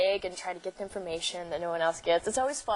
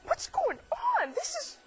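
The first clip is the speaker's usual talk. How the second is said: high, teary, panicked squeak. Retold or imitated. Star-struck guest panics before meeting the actress, high and teary. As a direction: pitch it way up like you're about to cry. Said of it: "I start crying".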